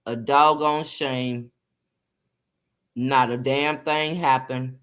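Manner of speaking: low, slow daddy voice. Acted. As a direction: lightly put on dad's low, weary-paced verdict. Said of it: "In my daddy voice".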